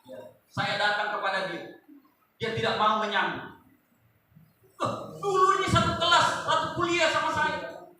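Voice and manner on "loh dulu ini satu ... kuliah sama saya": high, disbelieving complaint to a friend; indignant surprise. Retold or imitated. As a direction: ask with hurt surprise. His own retold disappointed question. (heran dan kecewa, nada tinggi)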